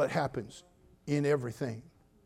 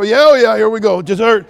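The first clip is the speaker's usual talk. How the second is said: loud high delighted yell; eager cheer; 6 semitones higher, 15 dB louder than usual. Retold or imitated. Stuffed guest cheering the dessert call. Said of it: "You go".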